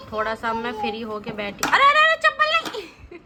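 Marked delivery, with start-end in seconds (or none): 1.7-3.2 alarmed call. Real, not acted. Mother stops her daughter grabbing a slipper, high alarmed shout.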